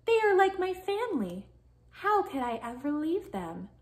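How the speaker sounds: high plaintive start, sinking down; a little sad. A narrator's reading. Hermit Crab thinks about leaving his friends, mildly sad. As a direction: let the voice fall away wistfully. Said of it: "Hermit Crab's feeling a little sad".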